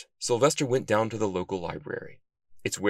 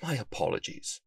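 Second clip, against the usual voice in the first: chastened apology; 2 semitones higher, 6 dB quieter than usual. A narrator's reading. Chastened reply after being shushed.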